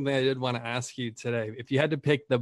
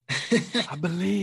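mock encouragement; 6 semitones higher, much slower than usual. Teasing encouragement to cohost.

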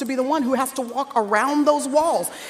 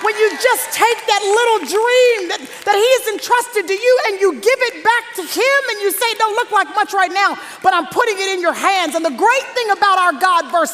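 high, raised, urgent preaching voice; impassioned preaching; 6 semitones higher than usual. Real, not acted. Preacher surges after hushed moment.

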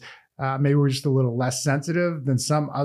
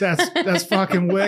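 put-on Boston accent, laughing; 5 semitones higher than usual. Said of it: "I used to say shit like".

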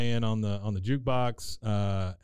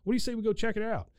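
eager Hal; 8 semitones higher than usual. Retold or imitated. Hal comes back with.

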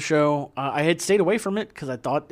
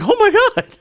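shocked exclamation; 10 dB louder, faster than usual. Retold or imitated. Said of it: "He's like".

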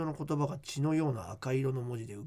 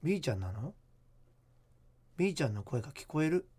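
hushed creepy ghost story; 3 semitones lower than usual. Acted. Reads listener ghost letter.

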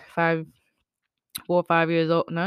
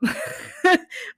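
high unvoiced laugh; breathy laugh; 14 semitones higher, 5 dB louder than usual. Real, not acted. Recalling childhood mischief, breathy high laugh. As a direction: laugh at your own story. Laughing at her own story.

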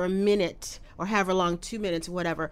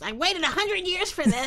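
mock Lisa; 11 semitones higher than usual. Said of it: "I'll speak for Lisa".

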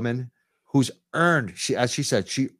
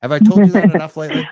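fast, loud and high; gushing; 6 semitones higher, 14 dB louder, much faster than usual. Real, not acted. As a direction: rush it out, overjoyed. Gushing at caller.

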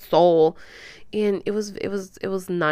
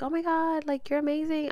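high, light admiring gush; retold gushing; 8 semitones higher, 4 dB quieter than usual. Client telling her therapist she admires her, mild gushing. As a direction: imitate gushing praise in a raised pitch.

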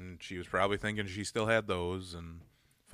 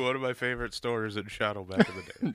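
usual speaking voice

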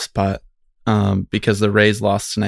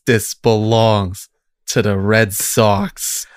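slow and deadpan; slow, solemn quote; much slower than usual. Retold or imitated. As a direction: drag each word out solemnly. Mocking the fan's quote.

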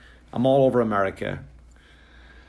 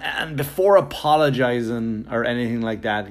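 usual speaking voice

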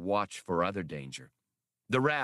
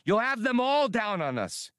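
shouted; 11 semitones higher than usual. A narrator's reading. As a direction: yell it high in alarm. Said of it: "Hasty shouted".

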